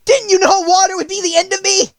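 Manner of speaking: high, loud despairing wail; anguished witch wail. A narrator's reading. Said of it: "in a wailing, despairing voice".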